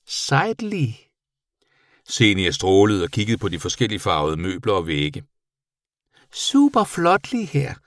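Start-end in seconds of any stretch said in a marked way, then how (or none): 0.1-1.0 very high little creature voice; impressed bat. A narrator's reading. Bat impressed by the boy's superhero, high voice. 6.3-7.7 very high, drawn-out creature voice; admiring bat. A narrator's reading. Bat admiring the boy's room, high and slow.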